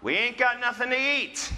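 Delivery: mock whiny teenager shout. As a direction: yell to Mom from the fridge. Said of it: "shouts out and says".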